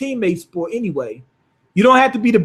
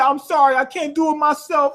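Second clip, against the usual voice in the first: higher-pitched mock apology; retold apology; 7 semitones higher than usual. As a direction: own up to it in a raised voice, retelling. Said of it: "He apologized to his teammates".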